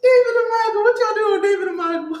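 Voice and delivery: loud, high-pitched shout to buddies; mock calling out. Joker hollers to his friend's dad and uncle, mock calling out. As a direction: holler their names in mock alarm.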